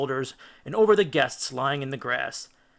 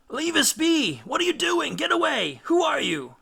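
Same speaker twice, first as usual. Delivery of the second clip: much higher, frantic shriek; 13 semitones higher, 3 dB louder than usual. A narrator's reading. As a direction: shriek in panic as they're dragged off. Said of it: "shrieked the lotus-eating Greeks".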